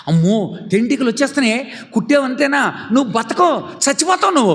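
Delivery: high, fast urgent warning; frightened mother. Acted. Mother warning daughter the stings could kill, frightened. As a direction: warn her child in a hurry.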